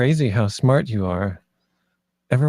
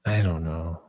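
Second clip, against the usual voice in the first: lower, softer unsure answer; uncertain murmur; 7 semitones lower, 7 dB quieter than usual. Acted. Inez admits to Blue she is undecided, quietly. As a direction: let it sink, unsure.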